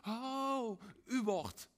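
high, loud alarmed turnaround; a panicked U-turn cry; sudden retreat. Retold or imitated. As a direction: yelp it like slamming on the brakes. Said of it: "dat we denken".

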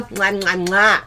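smacking air kisses to the viewers; blown kisses. Real, not acted. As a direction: blow kisses at the camera. Blowing kisses saying goodbye.

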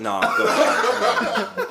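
high, laughing through words. Real, not acted. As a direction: giggle it out. Laughing while yielding.

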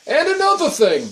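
put-on high-pitched old-man outburst; old man butting in, pitch jumping up then falling. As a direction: launch a cranky old man's complaint. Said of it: "I'll be like the old man".

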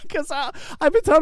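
high, loud, quick words after a laugh. Real, not acted. Explaining her mistake to a friend, laughing. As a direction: rush the explanation out through laughter.

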